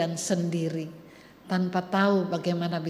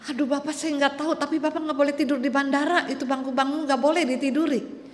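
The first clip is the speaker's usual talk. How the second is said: higher, impatient brush-off, a bit fast at first; annoyed clerk; 8 semitones higher than usual. Acted. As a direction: snap at the old man like a fed-up clerk. Annoyed clerk scolds old man. (petugas kesal mengusir bapak tua, nada tinggi dan ketus)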